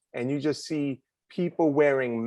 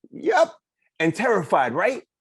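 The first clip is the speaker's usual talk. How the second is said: high excited reply; eager, high and bright; 8 semitones higher, 5 dB louder than usual. Acted.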